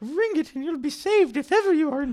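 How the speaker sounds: put-on a mother soothing her child; crowd mother.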